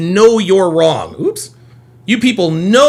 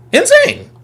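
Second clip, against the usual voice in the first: Insane. squealed much higher; 13 semitones higher than usual. Real, not acted. Reacting to caller on stream, high squeal. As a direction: yelp Insane. way up high in disbelief. Disbelief at a clip.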